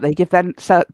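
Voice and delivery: normal talking voice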